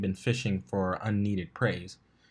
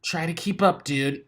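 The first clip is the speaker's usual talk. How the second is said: called back; 7 semitones higher than usual. A narrator's reading. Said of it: "she called back".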